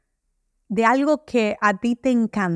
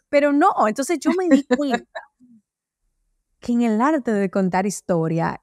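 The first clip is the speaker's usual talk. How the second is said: ordinary talk, nothing marked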